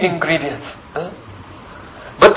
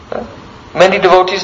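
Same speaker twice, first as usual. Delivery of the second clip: normal talking voice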